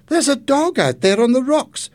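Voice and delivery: high, loud acted alarm; alarmed cry. A narrator's reading. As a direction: cry out a warning. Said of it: "she cried".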